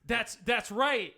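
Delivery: mock domineering. Dominant role play.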